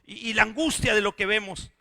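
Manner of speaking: loud, high, impassioned preaching; loud pulpit cry over the congregation; full-force preaching. Acted. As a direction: preach it with rising fire. Preaching build.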